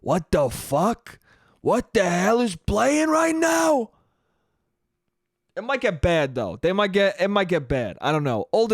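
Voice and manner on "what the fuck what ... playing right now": slow shouted what-the-hell, pitched up; dad's outraged reaction. Retold or imitated. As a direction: bellow it like a confused Italian dad. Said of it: "react like my dad and be like".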